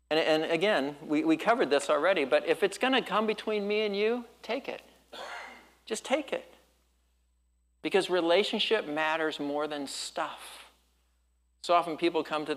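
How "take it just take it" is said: pleading softly. Real, not acted. Urging congregation to yield.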